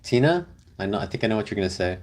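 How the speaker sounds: ordinary delivery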